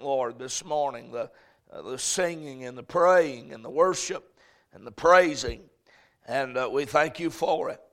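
fervent pastor's prayer. Acted. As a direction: pray over the congregation. Leads congregational prayer.

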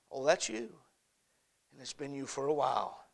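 soft, hushed voice of God gently insisting; God's quiet answer. Acted. God quietly tells the preacher it is him, hushed. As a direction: let God answer in a low hush.

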